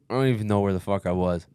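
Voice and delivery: ordinary talk, nothing marked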